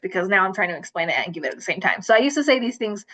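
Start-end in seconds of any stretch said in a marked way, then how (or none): none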